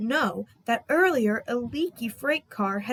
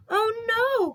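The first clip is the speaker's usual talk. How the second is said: coughing dismay; 12 semitones higher, 4 dB louder than usual. Acted. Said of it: "coughed Percy".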